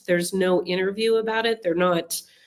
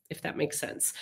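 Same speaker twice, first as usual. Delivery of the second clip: breathy whispered aside, nearly silent; hushed, hinting at more than she says; conspiratorial whisper; 3 semitones lower, 13 dB quieter than usual. Real, not acted. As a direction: drop to a knowing whisper, letting them in on it. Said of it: "trying to say, but not say".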